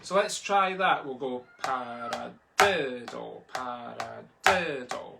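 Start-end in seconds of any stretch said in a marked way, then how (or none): 1.6-5.2 paradiddle chanted slowly, hitting "di"; slow, measured count with the third syllable punched; accent on third syllable. Shown as a demonstration. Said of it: "still catching all those accents".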